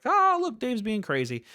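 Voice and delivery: high-pitched mock amusement; high, amused imitation of a guest. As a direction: squeak it out like a guest laughing it off. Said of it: "like, like".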